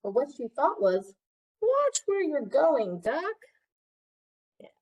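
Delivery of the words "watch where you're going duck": slow, high fussy hen. Acted. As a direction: scold him like a high-pitched hen. Chicken's thought, voiced.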